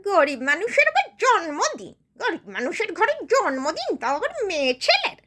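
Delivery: sharp, swooping, sarcastic complaint; scornful poor woman. Acted. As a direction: scoff bitterly, then grudgingly challenge them to deliver. Poor woman scoffs at birthday celebration.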